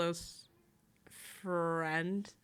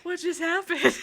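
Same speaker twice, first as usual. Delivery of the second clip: high, loud bewildered laugh; laughing confusion; 8 semitones higher, 9 dB louder than usual. Retold or imitated. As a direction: laugh out a baffled question. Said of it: "it's like".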